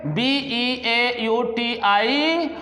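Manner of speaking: very slow, letters spaced out; slow spelling. Shown as a demonstration. Teacher spelling beautiful slowly for the class. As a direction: draw out each letter deliberately. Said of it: "मिला के लिखा जाएगा तो कैसे लिखेंगे".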